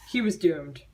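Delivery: ordinary talk, nothing marked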